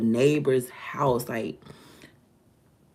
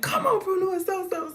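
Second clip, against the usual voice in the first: high urgent call to the dogs, pitched way up. Retold or imitated. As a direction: hurry the old dogs along, calling high. Calling the old dogs to run.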